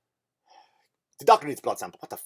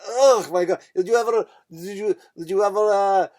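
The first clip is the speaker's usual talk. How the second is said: higher, louder mock Russian accent; 8 semitones higher, 6 dB louder than usual. Nurse searches for another comparison, mock Russian accent. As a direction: imitate a Russian woman groping for a comparison. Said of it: "here's what I noticed about the Russian accent".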